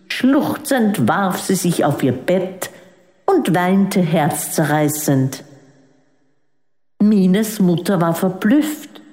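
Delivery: usual speaking voice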